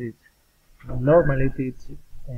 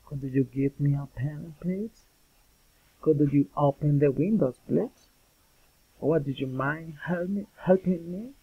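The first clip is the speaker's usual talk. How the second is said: polite requests climbing at "please" and "helping me"; rising intonation. Shown as a demonstration. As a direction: lift "please" and "helping me" sharply at the end. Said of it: "rising intonation".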